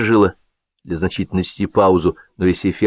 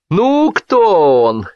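high, very drawn-out question; stretched, high-pitched teasing; egging on; 10 semitones higher, 4 dB louder, much slower than usual. A narrator's reading. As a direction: goad the guests into guessing. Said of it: "подзадорил Белявский".

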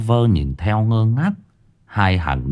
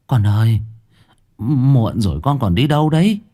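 trembling voice; 4 semitones higher than usual. A narrator's reading. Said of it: "giọng bà run rẩy".

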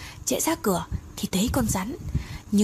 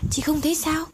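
insistent high question; 7 semitones higher than usual. Acted. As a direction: pitch jumps up, urging her to see it. Mai comforts Tê, repeats question.

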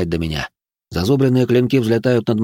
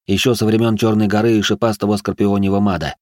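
usual speaking voice

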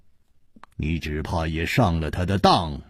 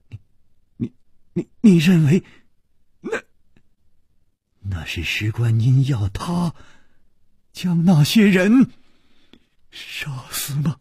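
higher, faltering, breaking at the end; stammering, close to tears; 7 semitones higher than usual. Acted. Li Yuhan stammers at Chu Liuxiang, voice cracking near tears. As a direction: stumble over the words, voice cracking.

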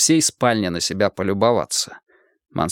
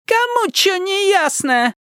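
much higher thin, whining voice; thin whiny voice; slower than usual. A narrator's reading. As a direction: squeal it, shrill and thin. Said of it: "зудящий тонкий голосок Мазура".

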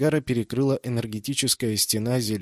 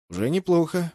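perked up; 6 semitones higher than usual. A narrator's reading. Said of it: "взбодрился капитан".

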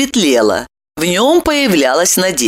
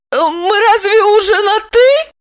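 very high, squeaky whimpering woman; startled through tears, pitched way up; tearful woman's question; 13 semitones higher, 4 dB louder than usual. A narrator's reading. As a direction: ask in a high, weepy squeal. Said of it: "перестала выть и спросила".

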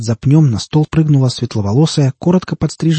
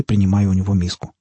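ordinary delivery

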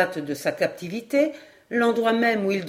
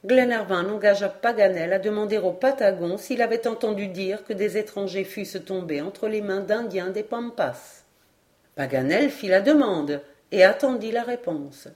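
ordinary delivery

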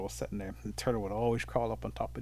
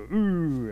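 high, loud collective wince; pained "ooh" at someone's fall; wincing "ooh"; 6 semitones higher, 7 dB louder than usual. Acted. Animals see the turtle land, high loud wince. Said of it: "They go".

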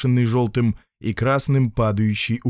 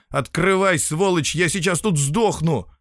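raised, furious pleading yell; 6 semitones higher than usual. Acted. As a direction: yell at the door in rage. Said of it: "с яростью набросился".

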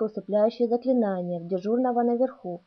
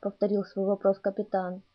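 plain reading voice

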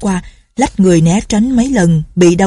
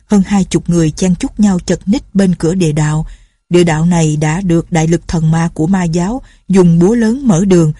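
usual speaking voice

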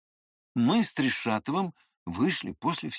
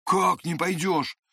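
raised-pitch startled question; startled alarm; 6 semitones higher, 3 dB louder than usual. A narrator's reading. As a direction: start up in mild fright. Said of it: "пугливо встрепенулся он".

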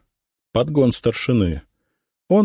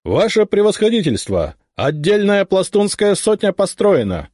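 shouting drill sergeant; 12 semitones higher than usual. A narrator's reading. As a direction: report to the general at full voice. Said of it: "докладывает сотник Иванов".

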